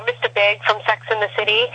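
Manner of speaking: ordinary talk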